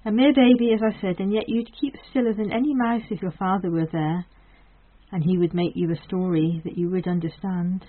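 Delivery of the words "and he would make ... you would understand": ordinary delivery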